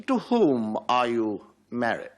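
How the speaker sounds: slow, drawn-out courtroom question; pompous counsel. Acted. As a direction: savour each word like a self-important barrister. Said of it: "a rather pompous counsel".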